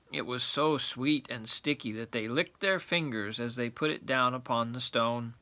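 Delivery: ordinary delivery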